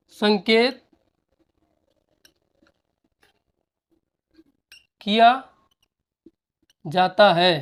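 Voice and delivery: one word at a time with long pauses; slow dictation. Shown as a demonstration. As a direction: dictate each word for the class to copy. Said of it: "dictating word by word".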